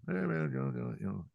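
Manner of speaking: high-pitched mock version of himself; self-mocking. Comic making fun of himself at a business meeting, self-mocking. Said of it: "I start making fun of myself".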